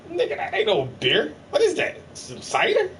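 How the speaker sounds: friend mocking. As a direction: scoff at the cider with a laugh. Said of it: "He was like".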